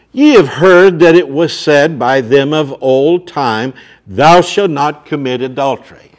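loud, ringing pulpit voice; booming sermon delivery; full-force preaching. Acted. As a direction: hammer the warning home to the crowd. Reads scripture loudly.

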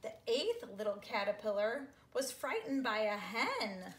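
hammed-up frightened storybook line; mock startled. A narrator's reading. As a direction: play up the scare for the kids. Frightened by a hen, loud.

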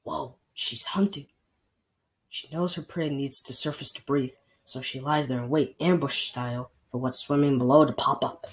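quiet nature-watch voice; hushed watching. Acted. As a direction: keep it down so the bear won't hear. Watching hunting bear quietly.

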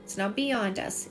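ordinary talk, nothing marked

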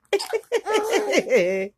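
high burst of laughter; laughing. Real, not acted. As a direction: crack up laughing. Grandma laughing at baby.

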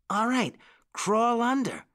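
put-on high-pitched boyish agreement; eager exclamation. A narrator's reading. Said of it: "exclaimed the other".

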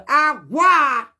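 a sudden high loud cry of disbelief; loud exclamation. Real, not acted. Telling the story, cries out in disbelief. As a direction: throw it out like you can't believe it.

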